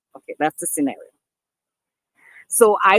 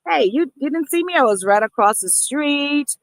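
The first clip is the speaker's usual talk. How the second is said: playful teasing; 8 semitones higher than usual. Retold or imitated. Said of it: "you could say things like".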